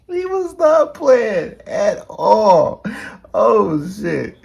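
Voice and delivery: high, loud and slow, laughing through it; laugh-crying. Real, not acted. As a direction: howl it out in disbelief. Shocked laughing at the shooting.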